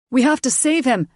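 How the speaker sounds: loud, high desperate cry. A narrator's reading. Layden cries out to save his dying brother, loud. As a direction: shout to save him. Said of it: "Layden cried".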